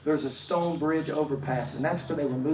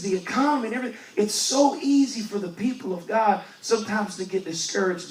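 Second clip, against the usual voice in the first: higher, louder preaching; preaching at full force; 4 semitones higher, 4 dB louder than usual. Acted. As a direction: drive the point home to the church. Preaching rises again.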